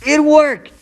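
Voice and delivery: high, loud burst of triumph; triumphant cry. Acted. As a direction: crow it out like you just won. Trick succeeds in fight.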